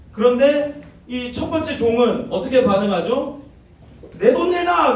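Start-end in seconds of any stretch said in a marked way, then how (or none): 4.2-4.9 much higher, sharp shout; mock demand. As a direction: yell at the debtor to pay up. Servant demands money back.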